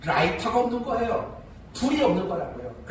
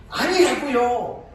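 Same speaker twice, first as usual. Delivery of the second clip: indignant protest; 6 semitones higher, 5 dB louder, slower than usual. Retold or imitated. Son denies accusation to elders.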